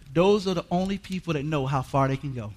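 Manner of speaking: normal talking voice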